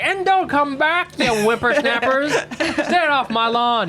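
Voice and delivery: high, drawn-out mock holler; old lawn-guarding grouch. Storyteller wishing to scold a thief, hammed-up grouchy holler. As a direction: ham up a grouch chasing off whippersnappers, slowly. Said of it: "I was just, like, continuing to yell at him".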